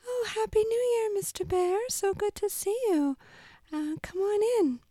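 very high, squeaky little mouse; chirpy tiny mouse character. Acted. Puppet skit, mouse character.

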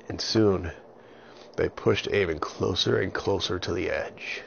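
plain reading voice

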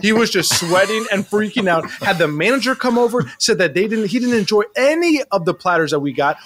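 normal talking voice

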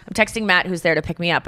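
usual speaking voice

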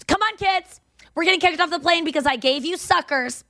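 high-pitched, retold huffy outburst; exasperated mother. Woman kicked off plane to her kids, mock exasperation. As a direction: imitate a fed-up mom rounding up her kids.

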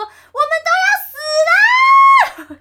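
very high, loud, drawn-out scream; panicked scream. Retold or imitated. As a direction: wail it in total panic. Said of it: "然后我就说".

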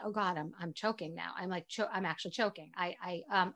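low, hushed flustered muttering; choking inner thought. Retold or imitated. Mom thinking she's choking before a kid, hushed flustered retelling. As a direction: stammer quietly to yourself, imitating social panic. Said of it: "And I thought".

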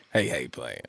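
low, soft catchphrase impression; Ryan Sickler. Letter reader imitating a podcaster's catchphrase, low soft impression. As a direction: drop it deep and quiet. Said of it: "Or if you're Ryan Sickler, it's".

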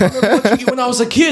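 high, fast, laughing gush; cringing at yourself while gushing; laughing mock fanboy. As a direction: giggle through an awkward compliment. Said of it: "all I could think of to say was like".

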